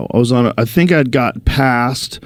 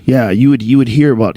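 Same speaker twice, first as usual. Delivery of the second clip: ordinary delivery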